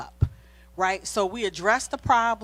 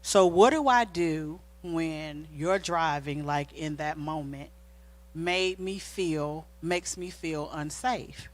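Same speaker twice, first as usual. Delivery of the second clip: slow, gentle asking; calm question; 3 semitones lower, slower than usual. Retold or imitated. Wife calmly asking husband about his driving, slow and gentle. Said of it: "I said, "Okay, honey".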